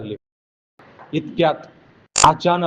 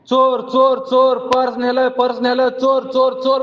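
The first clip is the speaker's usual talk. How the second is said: woman screaming thief; 8 semitones higher than usual. Retold or imitated. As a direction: yell चोर in panic at the thief. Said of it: "म्हणते, ओरडायला लागली". (घाबरून मोठ्याने ओरडणे)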